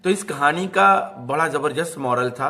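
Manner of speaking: plain reading voice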